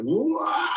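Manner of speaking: high, sudden wailing note; mock diva belt. Imitating [name] belting out a huge note. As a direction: belt it like a powerhouse singer.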